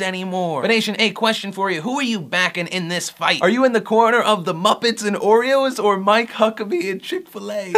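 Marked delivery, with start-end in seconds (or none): 3.4-7.7 laughing. Real, not acted. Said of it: "I can't say that without laughing".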